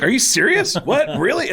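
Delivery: high, incredulous rising questions; can't believe what you just heard; stunned disbelief. Retold or imitated. Stunned that rich star pirates cable.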